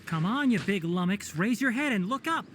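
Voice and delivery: hailing from below, a little high; calling out. Acted. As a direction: call out to get a big creature's attention. Calling up to a big creature.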